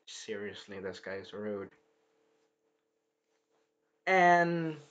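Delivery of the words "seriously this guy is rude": low background mutter, very soft; Sci-Fi. Retold or imitated. Sci-Fi comments from the background, very quiet and low. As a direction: say it under your breath, far in the back. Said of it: "Sci-Fi is saying in the background".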